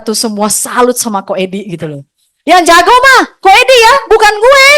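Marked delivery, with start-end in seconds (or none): 2.4-4.7 high, loud teasing voice; mock the colleague's praise of Ko Edi; imitating Ko Andre. Quoting Ko Andre to the audience, high loud imitation. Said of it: "Kalau kata Ko Andre ya".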